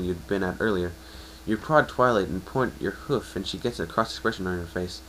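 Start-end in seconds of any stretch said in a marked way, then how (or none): none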